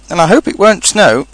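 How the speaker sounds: high, breezy afterthought; cheerful. A narrator's reading. Rabbit adding a thought for stuck Pooh, cheerful. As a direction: toss it in cheerily. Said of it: "said Rabbit cheerfully".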